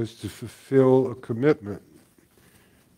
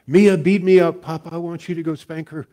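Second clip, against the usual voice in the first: loud, high mock wail, easing off in volume; crying granddaughter; 6 semitones higher, 4 dB louder than usual. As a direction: imitate a tearful child tattling to grandpa. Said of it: "crying again".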